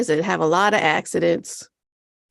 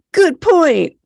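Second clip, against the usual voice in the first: higher, sudden relieved voice; mock relieved monk; 8 semitones higher, 6 dB louder than usual. Monk instantly convinced, higher relieved. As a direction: gasp then agree brightly. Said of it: "And he's like".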